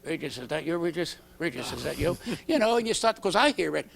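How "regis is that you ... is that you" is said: soft, low eerie call in the night; a hint of a spooky whisper; ghostly voice calling. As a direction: call him like a ghost from the dark. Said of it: "He started to hear at night".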